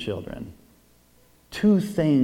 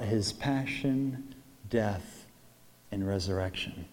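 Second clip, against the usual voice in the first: soft reflection; 5 semitones lower, 5 dB quieter, slower than usual. Acted. Priest repeats reflective question softer.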